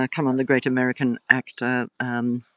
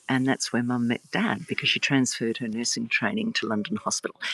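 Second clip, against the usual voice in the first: normal talking voice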